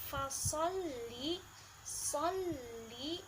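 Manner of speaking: fasalli with the ll pressed and held; pressed double l. Shown as a demonstration. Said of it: "lam bertasydid harus ditekan".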